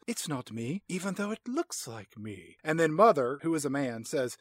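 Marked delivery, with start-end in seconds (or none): 0.1-2.6 soft, lightly acted denial; hushed mock protest; understated acted protest. Voicing Emma watching the video.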